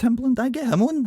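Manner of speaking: high, quick eager voice; naive festival booker. Retold or imitated. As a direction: pitch it up, fast and clueless. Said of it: "I'd be like".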